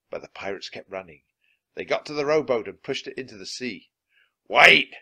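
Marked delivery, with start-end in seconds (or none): 4.5-4.9 very loud, higher call; captain's cry. A narrator's reading. Captain runs after his rowing men, crying out loud. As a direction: cry out to halt the crew. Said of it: "cried Captain Bones".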